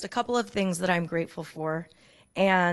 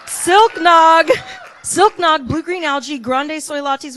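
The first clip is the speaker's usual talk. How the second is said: loud, higher cheering shout; joyful shout; 7 semitones higher, 9 dB louder, slower than usual. Real, not acted. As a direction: belt it out with delight. Shouting out gratitude list items.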